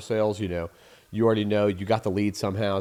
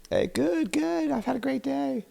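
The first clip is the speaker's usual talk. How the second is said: very high, softer put-on voice; mock friendly receptionist; 4 dB quieter than usual. Receptionist answering a caller's greeting, mock sweet falsetto.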